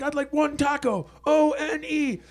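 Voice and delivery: high, loud, slow letter-by-letter shout; spelled-out shout. Retold or imitated. Bitchy friend shouting the order at the speaker box, slow and loud.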